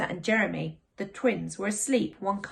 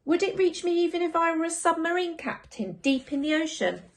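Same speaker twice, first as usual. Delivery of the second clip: put-on higher, eager child asking; a boy imagining adventures; lightly voiced child; 8 semitones higher than usual. Child character voice.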